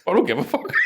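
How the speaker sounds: laughing high dad voice; laughing dad quote. Retold or imitated. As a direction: deliver the punchline laughing. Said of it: "He goes".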